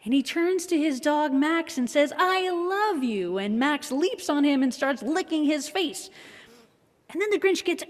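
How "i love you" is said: high, very slow, tender line. Retold or imitated. Grinch tells his dog.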